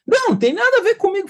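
fast, high-pitched retold protest; indignant client denial. Client rejecting the therapist's question, indignant protest. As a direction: cut in with an acted, heated denial.

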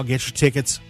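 normal talking voice

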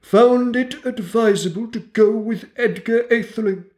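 pompous mouse lecturer. A narrator's reading. Mouse lectures the dripping animals, high and pompous. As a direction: lecture the wet animals grandly, like a tiny professor.

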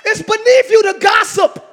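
fiery sermon shout; preaching shout. Real, not acted. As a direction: shout it from the pulpit. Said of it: "I'm screaming too much".

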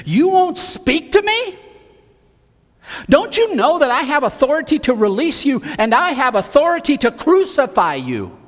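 corner the prisoner with your power; demanding Pilate. Acted. Preacher voices Pilate questioning Jesus.

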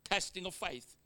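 normal talking voice

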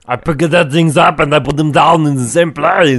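high, loud, slow mock Austrian accent; Arnold impression. Podcaster parodying the action star, loud Austrian accent. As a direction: ham up a bad Austrian strongman. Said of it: "how bad his accent is".

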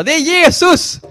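loud, very high shout; triumphant cry at full pitch; exclaimed revelation. Acted. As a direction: burst out with the big answer. Acted climactic revelation to Majken.

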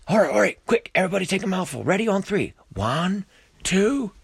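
high, loud hurried voice; rally the gang in a rush; frantic high-pitched countdown; urgent lizard. A narrator's reading.

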